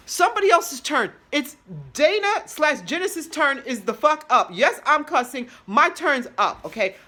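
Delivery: loud, high, fed-up tirade; indignant rant. Acted. Injured patient fed up with waiting, loud indignant rant. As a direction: demand your turn, loud and insistent.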